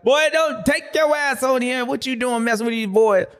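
hammed-up dad telling his son off, pitched high; father scolding his son at the race, mock high voice; imitating his dad. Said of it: "And his daddy was like".

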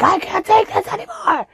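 mock high-pitched fed-up dad; exasperated dad. Worn-out dad giving up on his daughter, mock high whine. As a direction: throw up your hands, high-pitched. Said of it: "my dad was like".

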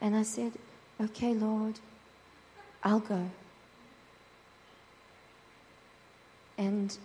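little whimpering voice. Retold or imitated. Woman in her car answering God, small and whimpering. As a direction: barely dare to say yes. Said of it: "this little whimpering voice".

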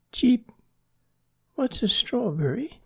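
very high little bird voice; baby chick. A narrator's reading. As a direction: squeak a puzzled question like a chick. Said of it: "asked Jerry".

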